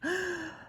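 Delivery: sharp high gasp of realisation. A narrator's reading. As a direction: gasp as it hits you. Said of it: "I did a gasp".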